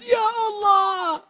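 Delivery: high, drawn-out mock weeping cry; acted tearful plea. Preacher acting out Ustadz inviting tears, tearful wail. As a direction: wail it slowly as if leading mourners. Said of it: "mengajak kita untuk menangis".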